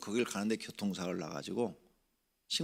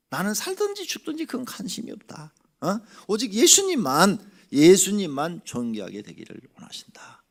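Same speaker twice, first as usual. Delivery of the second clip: higher, louder declaration; voicing Paul; 9 semitones higher, 9 dB louder than usual. Preacher speaking as Paul, high fervent proclamation. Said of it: "바울은 죽었어".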